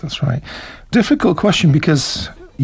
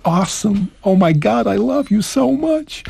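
American accent; 4 semitones higher than usual. Shown as a demonstration. Teacher playing an American in love, hammed-up American accent. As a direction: ham up an American declaration of love. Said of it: "Americans and Brits speak differently".

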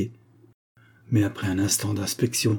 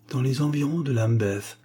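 ordinary delivery

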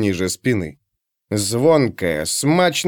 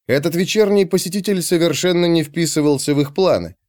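usual speaking voice

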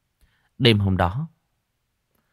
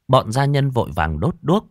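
ordinary delivery

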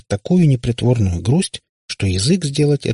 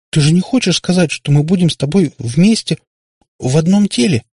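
high, loud, distressed disbelief; almost crying; 9 semitones higher, 5 dB louder than usual. A narrator's reading. Katya dreads sharing one body, almost in tears. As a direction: plead on the brink of tears. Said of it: "чуть не плача проговорила она".

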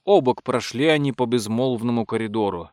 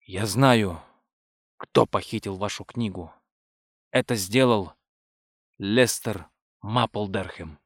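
low, measured disclosure with weighty pauses; solemn revelation; 4 semitones lower than usual. A narrator's reading. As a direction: reveal the culprit gravely, pitched down. Solemnly names the supposed culprit.

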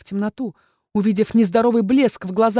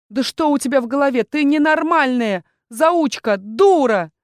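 angry scolding; 6 semitones higher, 4 dB louder than usual. Acted. Sirea berates the heroine.

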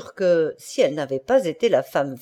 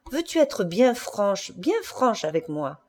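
slow, softer, coaxing question; 4 dB quieter than usual. A narrator's reading. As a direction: draw out the request, gently probing. Husband coaxing wife for confession. (enjôleur, lentement)

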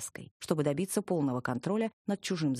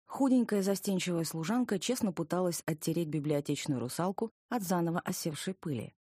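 usual speaking voice

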